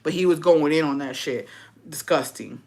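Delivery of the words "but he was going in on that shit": ordinary talk, nothing marked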